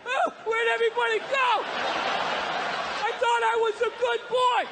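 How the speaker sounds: high, pleading, bewildered voice; bewildered good boy. Acted. Redneck abandoned, bewildered.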